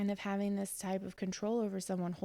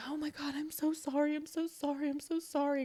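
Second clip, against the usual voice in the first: high, frantic mock sobbing apology; a retold four-year-old sobbing sorry; imitating a crying child; 8 semitones higher than usual. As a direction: play the panicked little boy in tears, lightly. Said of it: "and crying".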